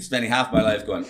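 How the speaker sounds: ordinary delivery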